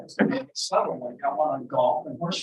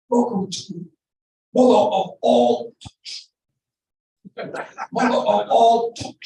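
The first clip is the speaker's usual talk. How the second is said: George Gallagher impression; 5 semitones higher, 6 dB louder than usual. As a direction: boom out a grand show opening in a put-on voice. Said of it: "do my George Gallagher impression".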